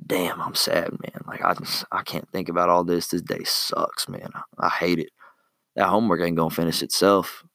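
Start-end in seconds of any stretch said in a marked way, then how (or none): 0.1-5.1 mope under your breath, dragging each word; sad moping. Pretend. Imagined student dreading homework.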